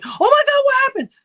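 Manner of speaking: imitated high, loud scream; screaming in alarm. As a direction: shriek it like a panicked sister. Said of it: "I could hear her start screaming".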